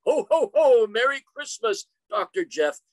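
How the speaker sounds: jolly Santa Claus ho ho ho, loud and high at the start. Acted. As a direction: boom it out like Santa on a hospital radio. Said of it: "And in my best".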